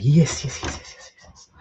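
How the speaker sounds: retold creepy whispered yeses; imitating his own creepy whisper. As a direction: whisper them out eerily. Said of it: "I was like".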